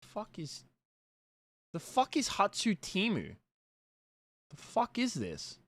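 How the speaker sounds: usual speaking voice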